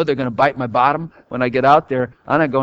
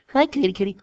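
lightly higher mock baby talk; 7 semitones higher, 3 dB quieter, faster than usual. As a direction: coo like at a kitten.

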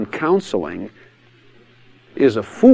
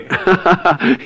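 loud, high belly laugh; amused cackle of recognition; hearty laugh; 7 semitones higher, 4 dB louder than usual. Shown as a demonstration. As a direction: burst out laughing, delighted. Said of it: "I always laugh".

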